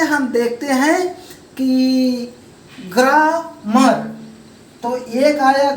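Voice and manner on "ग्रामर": ग्रामर slowly parted as ग्रा, मर; split into syllables. Shown as a demonstration. Teacher giving a two-syllable example, ग्रामर broken in two. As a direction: say ग्रामर in two distinct beats. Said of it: "इसमें हम दो बार जुबान को उठाते हैं".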